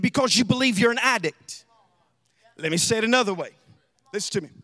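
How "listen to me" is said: normal talking voice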